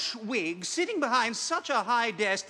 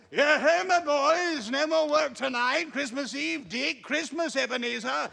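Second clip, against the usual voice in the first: put-on a jolly old master calling out; booming jovial old Fezziwig; fat jovial voice; 4 semitones higher than usual. Fezziwig calls the apprentices.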